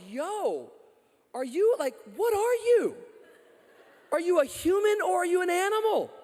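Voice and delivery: high, loud disbelieving challenge; incredulous outburst. Retold or imitated. Pastor confronting a lustful guy, loud incredulous challenge. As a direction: throw the question at him in disbelief. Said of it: "I'm like".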